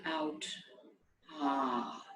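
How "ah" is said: softer, lower sighing ah releasing the breath; a quiet letting-go sigh. Shown as a demonstration. Guide showing listeners how to breathe out with ah, soft low sigh. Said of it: "breathe out with an ah sound".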